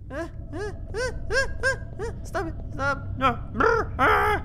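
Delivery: scared shrieks. Real, not acted. Chased in horror game.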